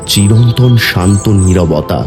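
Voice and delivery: ordinary delivery, nothing marked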